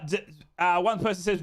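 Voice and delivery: ordinary talk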